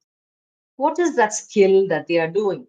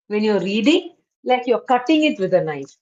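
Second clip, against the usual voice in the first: ordinary talk, nothing marked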